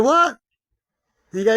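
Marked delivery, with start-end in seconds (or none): 0.0-0.4 high startled what; surprised what. Retold or imitated. Retelling a friend's baffled reply, high surprised. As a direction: act baffled, pitched way up. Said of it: "And they go".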